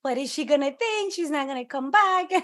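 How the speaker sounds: lightly voiced panicked worry; mock panic. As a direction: replay your own panic, half-laughing. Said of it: "I was like".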